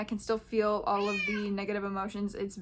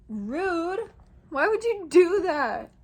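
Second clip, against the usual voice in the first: high, loud, drawn-out scolding; offended squeal at a rude interruption; playful indignation; 8 semitones higher, 6 dB louder, slower than usual. Real, not acted. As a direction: scold the interrupter in a raised, incredulous pitch. Scolds whatever just interrupted her recording.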